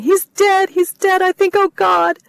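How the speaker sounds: high, loud, panicked sobbing cries; hysterical sobbing. Acted. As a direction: break down in hysterics on the phone. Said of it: "More sobbing".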